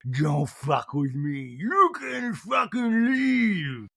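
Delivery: put-on slow, drawn-out drunken roar; Hulk [name] rage. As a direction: let the inner Hulk burst out. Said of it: "the Hulk [name]".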